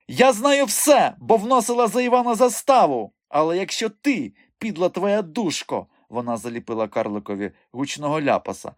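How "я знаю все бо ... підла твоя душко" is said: furious tirade. A narrator's reading. Enraged madam berates the dwarf. (гнівна високоголоса тирада)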